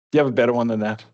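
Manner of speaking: normal talking voice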